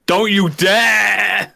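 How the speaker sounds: drawn-out yell of don't you dare; slow, loud mock warning; mock shouted threat. Shouting at the presenter over a nominee, slow loud yell.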